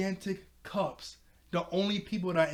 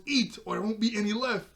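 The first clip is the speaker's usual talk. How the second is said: higher-pitched mock yell; retold yell; 6 semitones higher, 4 dB louder than usual. Starfire yelling at Robin over table manners, mock yell. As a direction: imitate her yelling, half in fun. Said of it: "she yelled at Robin".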